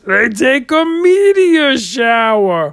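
high, loud, slow punchline; punchline delivery. Shown as a demonstration. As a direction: land the punchline big and slow. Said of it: "And I say".

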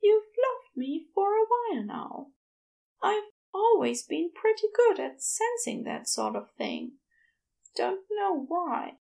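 high, lilting character voice; high-pitched, drowsily pleased with himself; sleepy Aziraphale. Acted. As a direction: muse fondly in a high, dreamy voice. Same figure, still sleepy.

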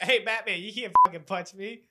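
loud, brash Brooklyn-ish gangster; a loud mocking Penguin taunting Batman. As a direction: bark it like a cocky crook. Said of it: "And he's like".

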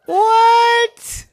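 shrieked what; shocked outburst. A narrator's reading. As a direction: cry out in disbelief like a child. Yvette shocked.

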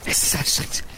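panting uphill while fleeing; breathless from running uphill; out of breath. Acted. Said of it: "Wald immer auf dem Berg".

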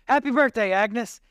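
high, loud surprise-party shout; crowd yell. A narrator's reading. Whole diner greets Agnes, loud high yell. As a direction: burst out cheering her name. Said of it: "Everybody yelled together".

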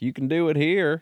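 high mock Southern accent; exaggerated Southern accent, high. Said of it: "stereotypical Southern accent".

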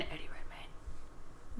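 slow hushed whisper; slow conspiratorial whisper about the pairing. Real, not acted. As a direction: breathe it like a secret.

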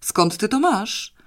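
father demanding an answer; urgent shouted question; shouting. A narrator's reading.

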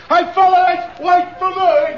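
high, loud call after friends; hollering after the group leaving. Acted. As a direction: shout to the fellas to wait up. Calling after the departing crowd.

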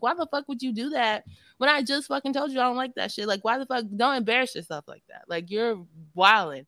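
indignant rant, slowing at the end; retold going off. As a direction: act out going off on someone. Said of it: "So I'm, like, going off".

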